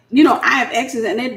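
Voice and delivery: ordinary delivery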